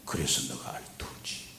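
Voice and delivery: hushed unvoiced whisper; whispered voice of God. God whispering to the weary pastor. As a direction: breathe it like a secret from above. God speaking to him softly.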